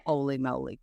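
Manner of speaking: lightly voiced low mock dismay; a low, put-on groan of dismay. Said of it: "when you're like".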